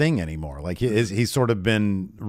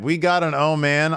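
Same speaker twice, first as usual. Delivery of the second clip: excited; 6 semitones higher than usual. Real, not acted. Recalling his excited reaction.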